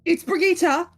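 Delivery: high, loud correction; insistent child. Acted. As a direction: correct a grown-up firmly in play. Child corrects mother's form of address.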